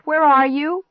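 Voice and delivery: high, loud searching question; shout. A narrator's reading. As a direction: call through the house. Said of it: "I shouted".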